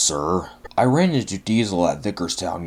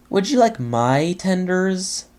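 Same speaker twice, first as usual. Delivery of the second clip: higher, slow, sweetly innocent offer; 9 semitones higher than usual. A narrator's reading. Duck tricks Henry with an offer, innocently higher. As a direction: bait the trap with wide-eyed innocence. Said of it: "asked Duck innocently".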